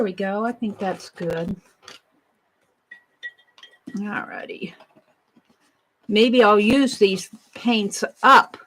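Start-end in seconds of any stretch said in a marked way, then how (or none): 4.0-4.7 whispered aside. Real, not acted. Crafter muttering to herself.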